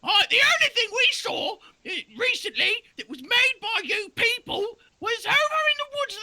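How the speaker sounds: a shrill cartoon doe; high-pitched doe voice; squeaky deer voice. Doe answers druid.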